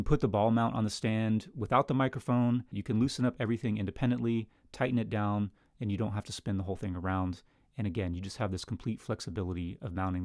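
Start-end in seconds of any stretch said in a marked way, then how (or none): none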